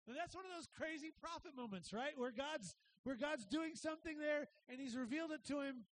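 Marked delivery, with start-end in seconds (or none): none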